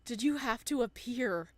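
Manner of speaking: high, loud, desperate accusations; anguished shouting. Acted. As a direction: shout at her, close to breaking. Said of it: "His voice cracks and fissures".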